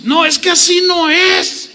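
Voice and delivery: high shouted protest; a wife shouting at the pastor in counseling; wife's shout. Acted. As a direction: yell it back, refusing to be corrected. Acted wife quarreling in counseling.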